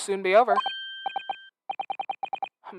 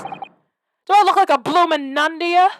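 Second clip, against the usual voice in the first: higher, slower mock-offended cockney voice; slow put-on cockney retort; 6 semitones higher, 4 dB louder, slower than usual. Man scolding the actor for calling him a nun, in a slow put-on cockney voice. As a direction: drag it out like a rough London geezer. Gruff man answering the insult.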